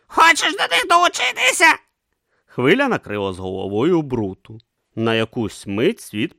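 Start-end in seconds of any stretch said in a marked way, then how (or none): 0.1-1.8 high, loud urgent pleading; urgent shouting. A narrator's reading. Om urging Brutha to jump, loud and urgent. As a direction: shout it, desperate and fast. Om retorts.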